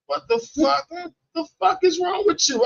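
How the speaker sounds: high-pitched mock outrage; exasperated. Customer berating Subway worker, exasperated. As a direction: retell it as disbelief, voice way up.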